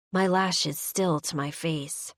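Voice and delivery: usual speaking voice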